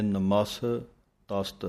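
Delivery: ਨਮਸ then ਤਸਤ, with a pause between the two halves; three letters, a pause, then the last three; syllable split. Shown as a demonstration. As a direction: break the word after the first three letters: ਨਮਸ, ਤਸਤ. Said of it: "ਤਿੰਨ ਅੱਖਰ ਪਹਿਲਾਂ ਬੋਲ ਲੈਣੇ ਹਨ".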